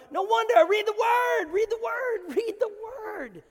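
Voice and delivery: high loud repeated command; preacher shouting read the word; full force preaching. Acted. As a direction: hammer the phrase home.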